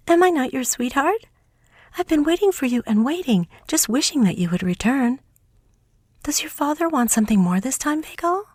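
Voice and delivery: high, eager little mouse princess; high little mouse, fond and eager. Acted. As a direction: play the tiny mouse sweetheart, high and lively. Mouse sweetheart speaks to Veiko.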